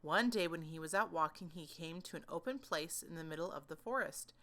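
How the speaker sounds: plain reading voice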